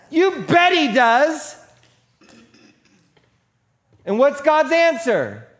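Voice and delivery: high, loud, full-force sermon delivery; impassioned preaching. Real, not acted. Preacher challenging the congregation about faith and prayer, impassioned. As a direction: drive the argument home at full voice.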